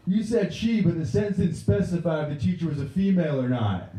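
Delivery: put-on high-pitched kid objecting; indignant student. Student correcting the teacher's assumption, high indignant kid voice. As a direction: argue it in a high pupil's voice. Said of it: "And he goes".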